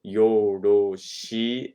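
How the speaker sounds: slow vowel drill; drawn-out syllables. Shown as a demonstration. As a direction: stretch "yoroshi" out very slowly, syllable by syllable. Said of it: "these vowels are very simple".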